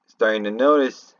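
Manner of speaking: ordinary delivery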